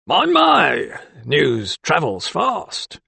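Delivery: high "my, my", then slow, mocking; mock him with a snigger in the voice. A narrator's reading. Keeper sniggering to magistrate at doctor's news. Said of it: "he sniggered".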